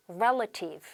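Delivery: "relative" with first-syllable stress, REL-a-tive; correct pronunciation. Shown as a demonstration. Teacher modelling "relative" with stress on the first syllable. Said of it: "발음할게요".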